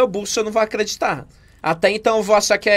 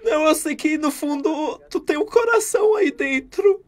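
higher, crying appeal to his heart; acted tearful plea; 5 semitones higher than usual. Victim appealing to the robber's heart, tearful. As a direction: weep and appeal to his mercy. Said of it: "você é muito boa atriz".

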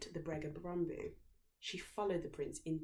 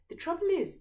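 high, louder, theatrical sigh; mock-regretful prince; 13 semitones higher, 8 dB louder than usual. A narrator's reading. Prince pretending regret before dinner. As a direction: set up bad news playfully. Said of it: "the prince said".

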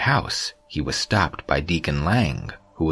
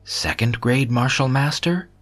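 high, rising astonished question; startled outcry; 8 semitones higher than usual. A narrator's reading. Father discovering his son's real rank, startled cry. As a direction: blurt it out in high-pitched disbelief. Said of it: "he cried out involuntarily".

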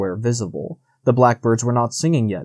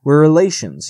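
louder, higher narrated plea; insistent pleading; 4 semitones higher, 6 dB louder than usual. A narrator's reading. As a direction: plead insistently, as a storyteller's fox. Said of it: "he pleaded".

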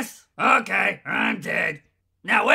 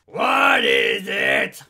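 much lower, gruff shouted question rising at the end; gruff demand; much slower than usual. Acted. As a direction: bark it out like a fed-up soldier. Grumpy voice bank.

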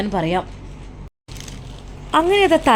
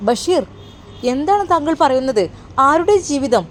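raised pitch, urgent questions; alarmed disbelief; 5 semitones higher than usual. Acted. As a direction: demand to know, taken aback. Employer shocked at ruined lives. (ഞെട്ടലോടെ, ഉയർന്ന സ്വരത്തിൽ)

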